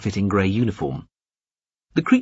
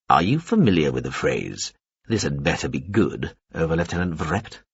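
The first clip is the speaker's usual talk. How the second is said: low menacing Veppers; 5 semitones lower than usual. A narrator's reading. Veppers towering over the alien, low and menacing.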